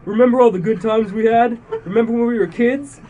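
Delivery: high, loud desperate begging; fearful pleading. Acted. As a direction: plead with an old friend. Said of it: "his voice trembling with fear".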